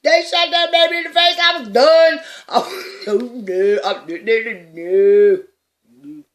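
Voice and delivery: high, loud sobbing wail; shrieking hysterical sobs, high and loud; hysterical crying. Retold or imitated. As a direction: wail it out like a teen who just saw a massacre. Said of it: "And so I'm hysterical in the car".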